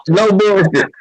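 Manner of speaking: ordinary talk, nothing marked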